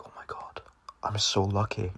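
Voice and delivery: hushed, voiceless aside to himself; whispered relief. Retold or imitated. Kid sees a friend at the door, whispered relief. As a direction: whisper it as a secret thought.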